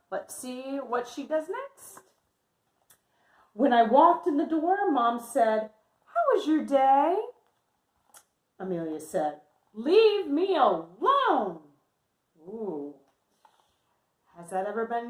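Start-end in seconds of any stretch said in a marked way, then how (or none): 3.5-11.6 lively picture-book read-aloud voice; reading to children. Acted. Teacher reading Amelia's story to her class, lively.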